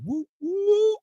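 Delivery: high, whooping cheer; cheering woo. Acted. Guest giving shout-out, whooping cheer.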